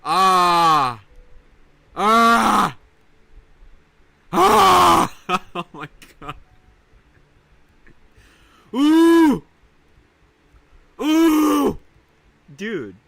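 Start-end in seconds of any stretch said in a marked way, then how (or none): 0.0-5.1 much louder, higher ah shouts; straining all-out yells; loud yelling. Real, not acted. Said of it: "do I have to yell louder to get higher". 8.7-11.8 very high, very loud ooh yells; piercing ooh screams; high ooh shrieks. Real, not acted. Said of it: "do I have to yell louder to get higher".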